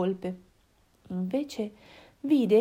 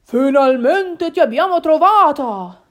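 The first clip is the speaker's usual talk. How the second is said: loud, joyful exclamation; booming, happy father fox; happy Papà Volpe; 5 semitones higher, 10 dB louder than usual. Acted. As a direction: cry out in relieved delight. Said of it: "esclamò Papà Volpe felice".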